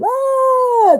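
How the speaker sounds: very high, short squeal; a tiny animal shrieking as it bolts; squirrel's startled squeak. Retold or imitated. Squirrel frightened by the pushy nuts, high squeak.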